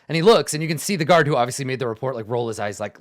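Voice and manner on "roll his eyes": usual speaking voice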